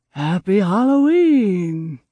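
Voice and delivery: slow, loud, drawn-out spooky croon; Nightmare Before Christmas voice. Acted. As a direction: stretch it out like a Halloween ghoul. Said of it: "in my Nightmare Before Christmas voice".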